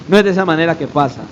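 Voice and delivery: usual speaking voice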